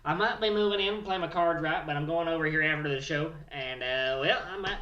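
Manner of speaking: rowdy hillbilly Bill bragging about his wedding news. Acted. Character Bill continues.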